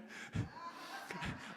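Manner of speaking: soft unvoiced laugh; a quiet airy chuckle; little breathy laugh. Real, not acted. Laughing at own joke.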